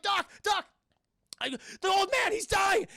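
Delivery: high, loud, urgent call for help; panicked shout. Retold or imitated. Chad calls the doctor to the dying man, panicked shout.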